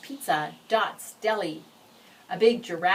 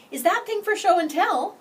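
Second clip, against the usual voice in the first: put-on high, loud eager boy's voice; a bus kid blurting out a question; curious boy; 13 semitones higher, 9 dB louder than usual. Boy on bus asks loudly.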